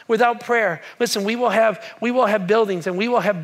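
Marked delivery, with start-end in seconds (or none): none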